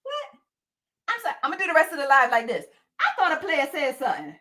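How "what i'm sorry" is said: usual speaking voice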